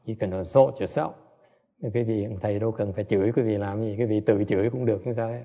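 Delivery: ordinary delivery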